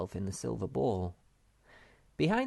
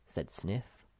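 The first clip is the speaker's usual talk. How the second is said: usual speaking voice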